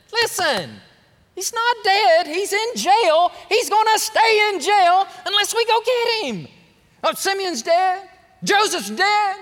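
high, loud exaggerated sulk; hammed-up whining. Preacher mocking a sulking dad, high and hammed-up. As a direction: overplay the self-pity for laughs.

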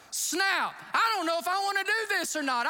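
retold fear. Pastor balking at the air force crew's warning, alarmed. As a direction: sound spooked by the eject briefing. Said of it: "I was getting fearful".

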